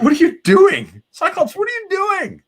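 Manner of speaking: very high, loud panicked cry; frantic yell. Acted. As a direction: shriek like a panicked hero. Wolverine yelling at Cyclops.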